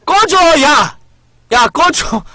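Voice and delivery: loud, high shout of get lost; angry yell. Real, not acted. Shouting at the friend who keeps teasing, angry yell. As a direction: bark him away.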